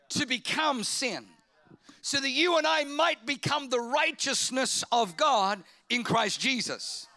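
loud, sustained preacher build; preaching at full force. Acted. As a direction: lift the room with the good news. Preaching climax.

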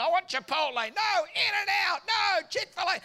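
put-on high, shouted kid voices; squabbling kids. Kids fighting over dinner, shrill and high.

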